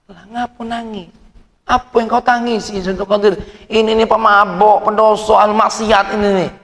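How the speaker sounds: tinggi dan keras, menegur marah; murid mengamuk. Retold or imitated. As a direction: murid memprotes tangisan syekh. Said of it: "muridnya ngamuk lagi". (murid mengamuk dengan suara tinggi)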